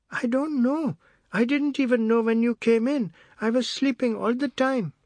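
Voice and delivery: put-on frightened boy. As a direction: protest innocence like a scared child. Boy denying, scared.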